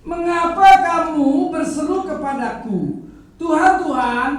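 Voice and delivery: loud preacher voicing Jesus' rebuke; loud reading of Jesus' words. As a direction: proclaim Jesus' challenge at full voice. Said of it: "saya ulang ya, baca ya".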